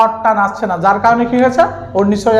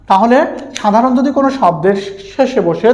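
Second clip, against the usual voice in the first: normal talking voice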